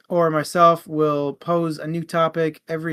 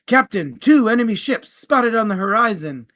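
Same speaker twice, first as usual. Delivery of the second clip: louder, higher alarm to the captain; urgent first mate report; 6 semitones higher, 8 dB louder than usual. Acted. First mate warns his captain of enemy ships, raised urgent call.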